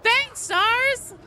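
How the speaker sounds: very high, loud shout of thanks. Real, not acted. Streamer thanking Stars mid-game, high excited call. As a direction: sing out your thanks at full pitch.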